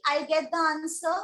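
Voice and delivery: ordinary talk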